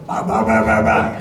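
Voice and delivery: low, loud growling monster noises. Pretend. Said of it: "monster voices at her".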